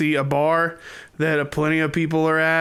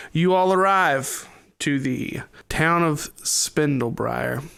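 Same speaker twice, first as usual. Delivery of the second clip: ordinary delivery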